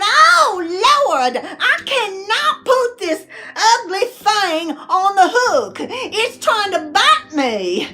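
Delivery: high, drawn-out comic panic; mock freak-out. Wife balking at baiting a worm, hammed-up freaking out. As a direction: wail it high, opening on a loud cry. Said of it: "I'm freaking out".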